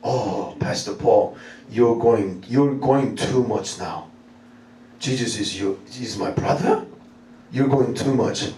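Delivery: low, slow, reluctant objection; doubting congregant. Acted. Church member thinks pastor goes too far, low doubtful drawl. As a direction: play a sceptical listener, pitched well down. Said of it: "Some of you are thinking like this".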